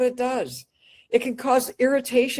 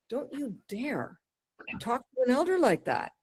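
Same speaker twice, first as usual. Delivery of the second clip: quiet scolding; 8 dB quieter than usual. Acted. Scolding the disrespectful budtender.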